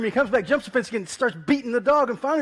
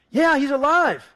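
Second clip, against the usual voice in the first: higher, louder and slow, pressing the point; insistent friend; 6 semitones higher, 7 dB louder than usual. Retold or imitated. Friend on the phone insists, loud and slow. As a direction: say it like you mean it, loud and slow.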